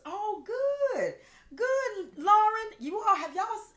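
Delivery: high, loud, delighted call to Lauren; loud squeal of joy as Lauren joins; excited greeting. Real, not acted. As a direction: burst out in a high, thrilled welcome. Greets Lauren joining the live chat.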